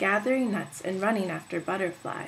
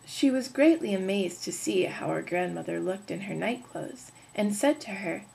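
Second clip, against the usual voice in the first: ordinary delivery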